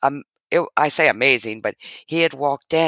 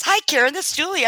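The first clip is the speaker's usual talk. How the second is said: mimicking Julie's greeting; much faster than usual. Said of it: "She goes".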